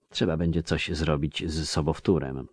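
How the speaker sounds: usual speaking voice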